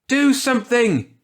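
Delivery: high, loud, desperate scream. A narrator's reading. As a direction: scream it as they hold you back. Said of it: "he screamed".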